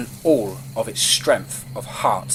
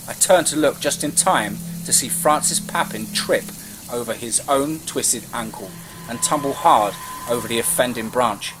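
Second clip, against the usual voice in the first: plain reading voice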